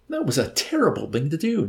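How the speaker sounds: high, reproachful outcry short of a shout. A narrator's reading. Nately rebukes the old man for the attack, reproachful. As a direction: scold him with mild indignation. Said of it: "Nately shouted at him reproachfully".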